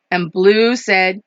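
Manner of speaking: usual speaking voice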